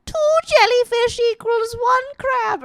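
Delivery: very high riddling creature; baby mermaid nymph. Acted. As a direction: chant it high like a tiny nymph. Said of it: "baby m-, mermaid nymph".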